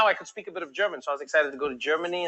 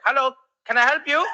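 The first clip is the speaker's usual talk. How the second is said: lightly voiced, higher-pitched emergency operator; South African operator; 8 semitones higher, 4 dB louder than usual. South African operator answers a nine one one call, higher-pitched role voice. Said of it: "if you had South African operators".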